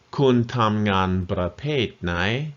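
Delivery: slow, every word of "kun tam ngan bra pet nai" spelled out; slow teaching demo. Shown as a demonstration. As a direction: model the phrase slowly for learners. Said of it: "One more time".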